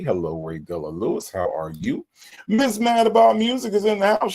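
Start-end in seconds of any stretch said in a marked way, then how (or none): 2.5-4.3 high, loud, bright host intro; host announcement. Real, not acted. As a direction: announce her like a showman. Announcing a viewer.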